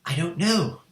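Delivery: ordinary delivery, nothing marked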